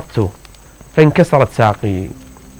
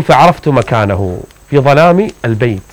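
ordinary talk

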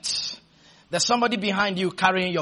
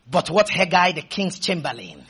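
ordinary talk

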